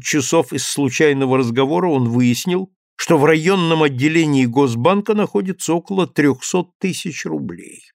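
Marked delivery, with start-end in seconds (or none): none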